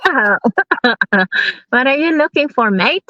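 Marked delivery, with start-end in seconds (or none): 1.7-3.0 higher-pitched Kiwi question, "mate" said like "mite"; playful New Zealand impression; imitated Kiwi speaker. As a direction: replay the local's question in Kiwi accent. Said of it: "Ganun din".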